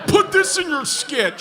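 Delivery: a hint of a smug heckler; mock heckler. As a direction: poke at the comic like a smug kid. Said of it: "some twenty-year-old kid who's like".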